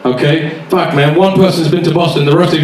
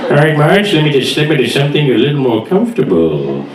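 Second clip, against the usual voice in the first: Homer Simpson; 2 semitones lower than usual. Acted. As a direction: play the cartoon dad getting frisky. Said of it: "Homer comes back to the house".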